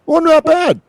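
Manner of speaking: high-pitched pleased surprise; light, high acted relief; mock pleasant surprise. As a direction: pop up high, relieved it went fine. Imagined pleasant surprise after the gig.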